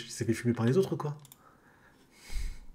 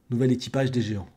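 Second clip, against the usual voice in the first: usual speaking voice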